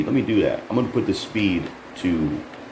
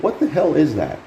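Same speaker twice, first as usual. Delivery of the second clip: incredulous disbelief; 9 semitones higher than usual. Real, not acted. Reacting to bizarre trailer clip.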